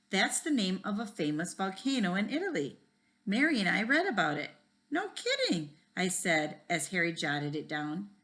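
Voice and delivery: put-on reading a chapter book aloud to kids; lively read-aloud with voices for each kid; story reading. A narrator's reading. Teacher reads a school mystery to the class, lively.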